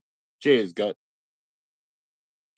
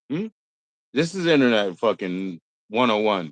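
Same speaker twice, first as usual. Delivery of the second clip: normal talking voice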